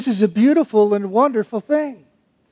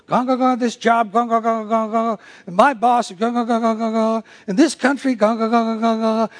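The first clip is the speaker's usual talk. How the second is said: mock grumbling. Mocking grumbling coworkers.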